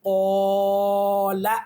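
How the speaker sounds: ओला drawn out very slowly, vowel held; long recitation. Shown as a demonstration. Teacher reciting a practice word, long and slow. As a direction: draw ओला out like Quran recitation. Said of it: "कलिमात के अंदर इसको apply करेंगे".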